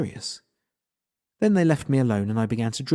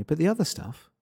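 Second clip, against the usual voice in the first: higher-pitched line left hanging; trailing off; 5 semitones higher than usual. A narrator's reading. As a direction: let OTHER STUFF drift off unfinished. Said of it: "She trailed off".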